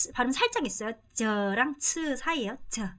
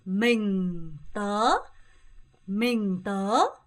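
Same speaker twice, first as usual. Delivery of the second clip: very slow model; 4 semitones lower, much slower than usual. Shown as a demonstration. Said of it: "한 번만 더 읽어볼게요".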